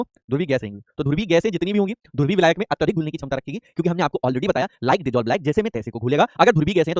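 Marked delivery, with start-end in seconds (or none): 0.0-0.8 low, soft answer dropped in; quiet answer. Shown as a demonstration. Teacher quietly answers his own question. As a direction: murmur the answer under the question. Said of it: "क्या रही होंगी दोनों?".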